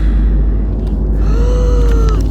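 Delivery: loud, high theatrical gasp; dramatic gasp. Acted. Storyteller gasping in mock horror at the monster.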